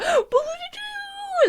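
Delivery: very high wind-chimey sound; high, airy imitation of a chime; imitated chime of success. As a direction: sing out a little success jingle. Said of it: "wind chimey sound of success".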